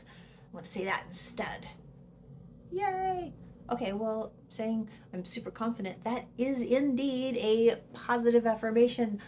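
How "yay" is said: high squeal of mock triumph; sarcastic little cheer. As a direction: cheer it like you've won. Mock celebration of affirmation.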